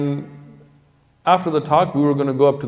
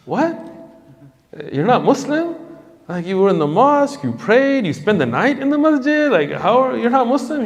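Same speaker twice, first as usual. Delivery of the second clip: shocked disbelief; 11 semitones higher than usual. Retold or imitated. Narrator reliving his shock at the convert's answer, disbelieving. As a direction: voice jumps up in total disbelief.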